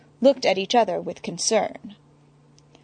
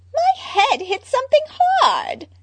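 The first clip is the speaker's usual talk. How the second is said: high squeaky Harley with a laugh; 4 dB louder than usual. Acted. Harley, handcuffed, complaining with a giggle. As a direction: play it dazed and giggly.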